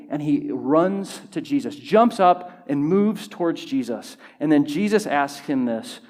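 usual speaking voice

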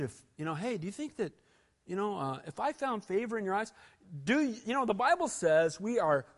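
ordinary talk, nothing marked

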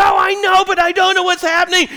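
high, loud frustrated protest; frustrated yell. Retold or imitated. Husband defending himself to his wife, loud frustrated protest. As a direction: plead at full volume. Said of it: "And I go".

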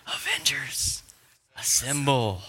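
hushed, dramatic battle command; Captain America. Acted. Preacher quoting the Endgame bone-chilling line, restrained and dramatic. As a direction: hold back the call, with a pause before the last word. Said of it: "Captain America says the bone-chilling line".

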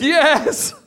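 high, loud joyful shout with a laugh; ecstatic yes. Retold or imitated. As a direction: burst out with laughing joy. Bursting in on friend with news.

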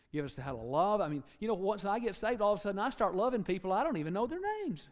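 normal talking voice

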